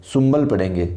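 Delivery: सुंबल with the n turning to an m sound, sumbal; correct pronunciation. Shown as a demonstration. Teacher showing the class how सुंबल is said, with an m sound. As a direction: say सुंबल as sumbal, lips closing before the b. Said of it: "सुनबल नहीं पढ़ेंगे".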